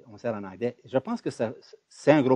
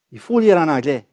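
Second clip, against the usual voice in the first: loud, higher-pitched imitated command; mock insistence; 5 semitones higher, 12 dB louder than usual. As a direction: lay it down loudly as a rule. Voices the insistence on English. (injonction imitée, forte et insistante)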